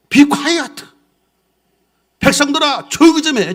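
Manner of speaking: high, loud command to hush the crowd; Caleb shouting. Acted. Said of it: "뭐라고 말합니까?".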